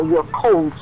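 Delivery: ordinary talk, nothing marked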